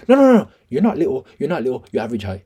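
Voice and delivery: high, loud panicky no's fading back to normal; frantic protest. Retold or imitated. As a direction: rush in to deny it, then calm down. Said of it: "He has to jump back in and say".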